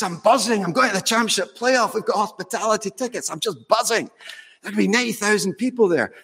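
lightly put-on Scottish voice, thrilled; Scottish accent. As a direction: imitate a giddy football fan with a Scottish accent. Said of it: "I put a Scottish accent".

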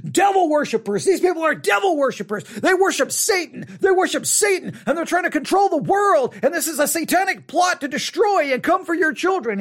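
high, shouting conspiracy rant; mock frantic shouting about devil worshipers; ranting radio host. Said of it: "he's yelling and screaming and ranting".

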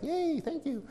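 put-on very high, soft little kid voice; happy child. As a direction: play a delighted small child.

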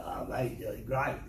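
imitated low outback Aussie mate; gruff Australian bushman impression; deep gravelly bushie. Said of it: "gravelly voice".

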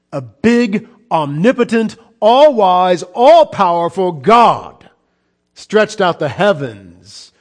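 loud, high, slowing proclamation; rising preaching shout. Real, not acted. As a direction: hammer out every attribute of God. Preacher builds to climax.

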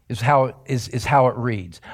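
normal talking voice

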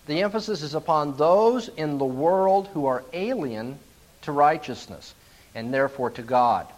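ordinary talk

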